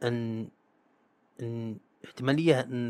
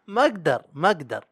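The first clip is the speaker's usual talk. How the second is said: laughing, can't get the word out; a laughing ma agdar, higher and louder; laughing give-up; 4 semitones higher, 5 dB louder than usual. Real, not acted. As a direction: give up through real laughter. Gives up laughing at stumble.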